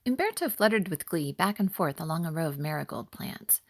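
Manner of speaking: ordinary delivery, nothing marked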